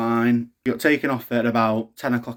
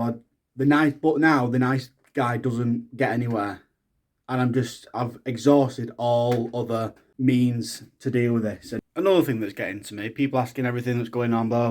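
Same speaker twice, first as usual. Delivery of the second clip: ordinary delivery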